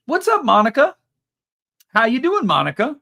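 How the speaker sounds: excited greeting. Real, not acted. Greeting a viewer in chat.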